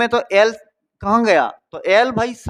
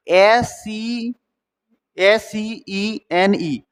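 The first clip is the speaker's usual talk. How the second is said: slow, letter-by-letter dictation; spelling out slowly; much slower than usual. Shown as a demonstration. Teacher spelling scene for students, slow spelling out. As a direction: draw out each letter with space between.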